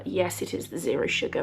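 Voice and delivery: usual speaking voice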